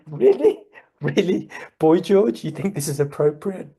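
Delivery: high, loud, disbelieving questions; mock incredulity. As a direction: imitate baffled elders querying the music choice. Voicing nonagenarians appalled by [name].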